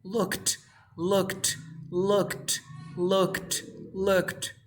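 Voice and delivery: "looked" as one syllable ending in KT. Shown as a demonstration. Teacher drilling the past ending, "looked" closing on voiceless T. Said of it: "you pronounce this like T".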